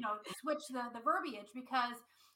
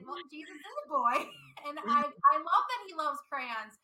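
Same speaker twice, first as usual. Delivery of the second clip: laughing through the words, a bit louder; laughing reply; 4 semitones higher, 5 dB louder than usual. Retold or imitated. Mother answering her two-year-old daughter, laughing. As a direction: chuckle while answering the child.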